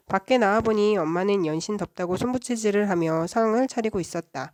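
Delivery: ordinary delivery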